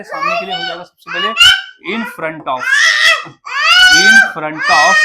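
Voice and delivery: sudden very high, loud in front of; high drill voice. Shown as a demonstration. Teacher shrieking the phrase high to drill students. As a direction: squeal it up high for emphasis. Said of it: "teacher drill, sudden high voice".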